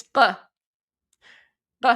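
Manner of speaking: isolated ق, throaty stop; Persian q sound. Shown as a demonstration. Correcting viewers, ق as a throaty q. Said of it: "it's not a soft G".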